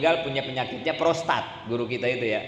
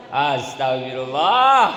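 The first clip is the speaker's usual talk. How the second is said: mock astagfirullah; 5 dB louder, slower than usual. Mocking misused istighfar again.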